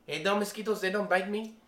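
put-on high, animated Filipino English accent, telling a tall tale. The Filipino pastor brags about commanding a cloud, high Filipino accent. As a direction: retell the story as the Filipino pastor in his accent. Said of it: "he used the Filipino accent".

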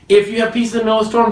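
fast, pressing line; urgent preaching; quick emphasis. Real, not acted. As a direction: rush it home.

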